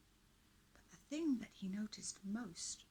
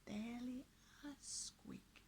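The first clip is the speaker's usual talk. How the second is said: whisper; 5 semitones higher, 7 dB quieter than usual. A narrator's reading. Squeak described, whispered.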